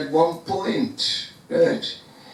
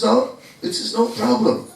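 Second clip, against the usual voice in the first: higher, fast, cocky brag; boastful Hanuman; 8 semitones higher, faster than usual. Acted. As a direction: brush it off like it's nothing, quick and proud. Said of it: "And Hanuman says".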